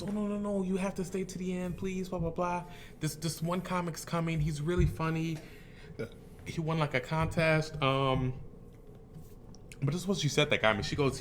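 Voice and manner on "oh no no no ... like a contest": high-pitched imitation of a gushing host; pleading host. Show host begging comic to stay, high imitated pleading.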